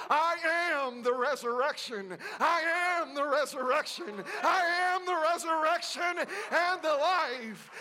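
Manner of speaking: rising preaching. Acted. Preacher building Jesus' words to a shout.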